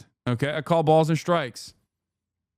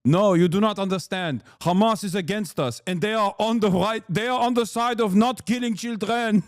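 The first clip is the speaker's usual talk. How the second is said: put-on Israeli accent, insistent; mock Israeli spokesman. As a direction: earnestly explain in a parody Israeli accent. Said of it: "they're constantly like".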